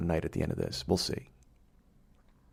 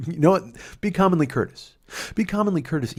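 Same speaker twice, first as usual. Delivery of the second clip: high, loud mock athlete voice; hammed-up football player voice; mock Enos impression; 11 semitones higher, 4 dB louder than usual. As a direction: imitate Enos saying be commonly courteous. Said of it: "Enos, former running back".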